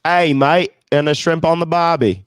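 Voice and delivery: lightly hammed-up Australian bloke; mock Australian. Joking she barely speaks Australian, mock Australian accent. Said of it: "semi-fluent in Australian".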